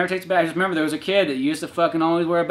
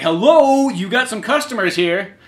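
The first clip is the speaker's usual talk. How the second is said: calling out; 6 semitones higher, 4 dB louder, slower than usual. Acted.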